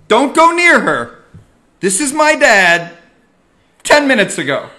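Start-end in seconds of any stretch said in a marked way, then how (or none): 0.1-1.2 loud, high warning to keep away. Retold or imitated. Son ordering his parents away from the guest, loud warning. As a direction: bark the order to stay back. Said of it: "I said".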